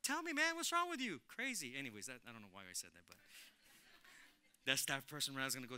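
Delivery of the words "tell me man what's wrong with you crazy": shocked complaint. Retold or imitated. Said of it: "I'm like".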